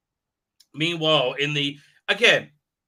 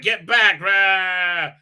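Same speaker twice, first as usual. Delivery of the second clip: louder shout, hammed-up warrior; mock battle shout; 4 semitones higher, 7 dB louder, much slower than usual. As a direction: roar him back with a glowing sword. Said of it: "and he's like".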